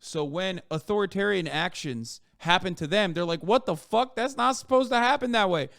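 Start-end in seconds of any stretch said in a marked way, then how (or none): 3.4-5.7 higher-pitched indignant disbelief; hammed-up protest, pitched up; mock outrage. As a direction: imitate someone losing it over unfair treatment. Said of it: "were losing their shit".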